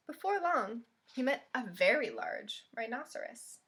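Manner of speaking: gentle, expressive storybook reading; picture-book read-aloud voice; reading to children. Acted. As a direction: read it like storytime for little kids.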